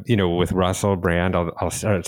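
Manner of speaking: ordinary talk, nothing marked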